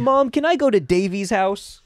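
put-on high, pleading child voice; little kid voice. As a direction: beg like a small boy. Child asking mom.

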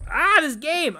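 loud, high cry of frustration; exasperated outburst. Real, not acted. Player fed up with a hard game choice, exasperated outburst. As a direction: burst out in exasperation.

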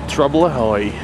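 usual speaking voice

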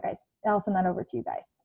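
normal talking voice

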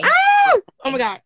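high shriek of delight; excited scream. Real, not acted. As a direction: scream with joy. Excited scream at name said right.

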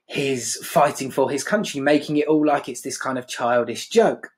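normal talking voice

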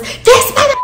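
high-pitched outburst of rage; sudden rage. Retold or imitated. Mood switched in a blink, sudden rage. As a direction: flip abruptly into a high, furious yelp. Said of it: "my mood just switched and I altered".

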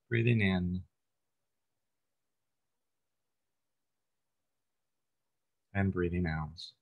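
calm, unhurried meditation guide, long pauses between phrases; guided meditation. Real, not acted. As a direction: gently lead listeners through breath and body, lingering on phrase ends.

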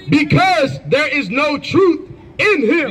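high, slow scripture reading; scripture declamation. Real, not acted. Reader proclaiming scripture at an opponent in debate, declamatory.

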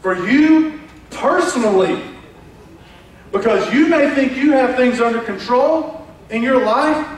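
high, loud, impassioned preacher voice; full-force preaching. Acted. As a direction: drive it home to the congregation.

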